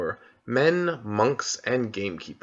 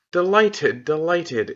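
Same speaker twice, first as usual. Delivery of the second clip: higher, louder, overeager faun; a faun gushing to hide his slip; a higher, overeager faun repeating himself; flustered faun; 6 semitones higher, 5 dB louder, slower than usual. Acted. Fawn covers slip.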